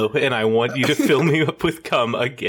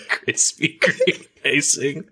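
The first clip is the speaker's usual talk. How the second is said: reading, then cracking up at the end; reading while fighting back giggles; breaking into laughter; 3 semitones higher, slower than usual. Real, not acted. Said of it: "I'm gonna do it once clean".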